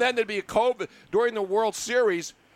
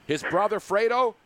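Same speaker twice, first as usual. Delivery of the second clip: usual speaking voice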